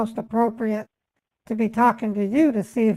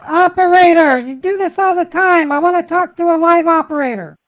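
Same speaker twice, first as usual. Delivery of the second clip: shouting, much higher and louder; frustrated shout; 7 semitones higher, 9 dB louder than usual. Real, not acted. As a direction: yell at the machine, fed up. Frustrated shouting at phone menu.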